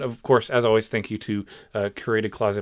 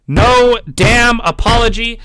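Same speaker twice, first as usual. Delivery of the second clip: slow, loud and much higher, spitting out each word; furious outburst; 10 semitones higher, 10 dB louder, much slower than usual. Real, not acted. Vlogger ranting to viewers about a fake apology, furious slow outburst. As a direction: let the anger burst out, slowly.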